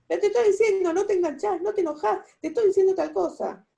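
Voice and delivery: reclamo exasperado, agudo y fuerte; enojo creciente imitado. Acted. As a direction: imitar a quien se enoja cada vez más. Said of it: "más se enojan ustedes". (insistir enojado, alzando la voz)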